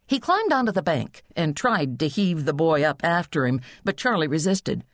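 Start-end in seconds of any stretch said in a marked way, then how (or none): none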